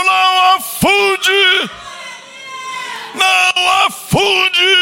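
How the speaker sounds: full-voiced rallying shout. Shown as a demonstration. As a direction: yell não afunde out to the church.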